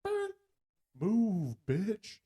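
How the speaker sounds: high yell out the window; shouting. Retold or imitated. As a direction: yell at traffic. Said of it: "drive down the road and just".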